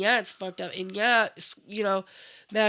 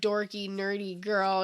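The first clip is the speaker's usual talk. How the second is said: usual speaking voice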